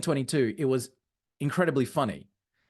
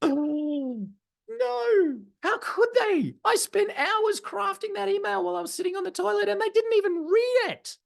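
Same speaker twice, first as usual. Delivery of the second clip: high-pitched, hammed-up wail of outrage; mock dismay; 11 semitones higher than usual. Speaker mimics marketer whose crafted email went unread, mock-devastated wail. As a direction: wail like a wounded email writer, much higher.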